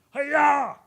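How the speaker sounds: high, loud mock karate cry; karate yell. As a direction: shout it like a kung fu strike. Tackler's karate-style yell.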